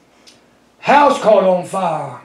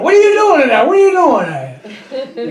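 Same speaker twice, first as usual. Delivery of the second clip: retold holler; 3 semitones higher, faster than usual. Firemen hollering at the wife running back into the burning house. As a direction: call out as if to someone inside a burning house. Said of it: "they're hollering at her".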